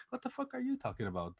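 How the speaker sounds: high-pitched incredulous question; amused, squeaky protest of innocence; retold disbelief. Accused friend answering the drama queen, mock disbelief.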